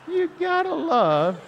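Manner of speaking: ordinary delivery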